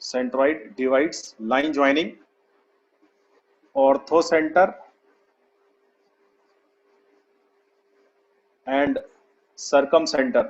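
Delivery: slow, word-by-word dictation; drawn-out words for students writing along. Real, not acted. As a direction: spell out the note slowly, pausing long between phrases. Teacher repeats dictation for note-taking.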